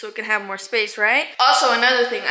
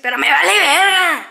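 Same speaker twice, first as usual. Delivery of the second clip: defiant shout; 4 semitones higher, 5 dB louder than usual. Real, not acted. Defiant Spanish outburst.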